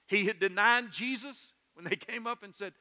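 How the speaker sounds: ordinary delivery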